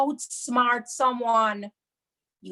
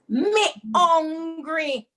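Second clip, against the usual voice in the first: slow, higher and louder, "Me hungry." whined; dramatic hungry whine; 6 semitones higher, 5 dB louder, much slower than usual. Shown as a demonstration. [name] acting out a hungry plea. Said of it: "you have to make it dramatic".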